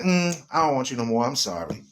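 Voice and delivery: low, mumbled brush-off, lightly voiced; dismissive man. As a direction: shrug her off with a quiet mock apology. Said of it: "he's like".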